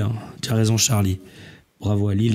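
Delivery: ordinary delivery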